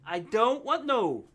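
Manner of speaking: high, slow "I don't want no"; botched accent imitation. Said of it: "You're not saying it right".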